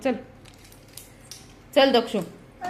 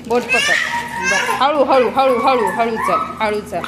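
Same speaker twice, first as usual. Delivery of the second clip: urgent coaxing; 2 semitones higher, 7 dB louder, slower than usual. Real, not acted. Mother guiding toddler's steps.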